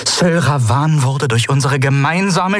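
high, cutting, authoritative rebuke; sharp syllables. A narrator's reading. Kalin rebuking the captain aiming at officers, sharp. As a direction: make every syllable cut like a blade. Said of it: "Jede Silbe von ihr war scharf".

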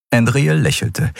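usual speaking voice

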